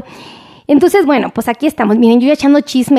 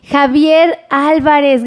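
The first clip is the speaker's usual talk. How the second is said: ordinary delivery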